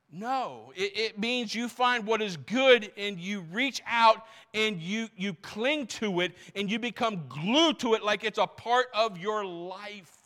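high, loud preacher's cadence, building; fiery preaching. Acted. Pastor urging the church to cling to good, fiery preaching. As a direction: preach it hard to the congregation.

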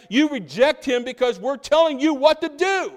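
loud, high-pitched command; domineering order. Acted. Religious leaders ordering the people to reject him, domineering. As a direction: lay down the law, loud and insistent.

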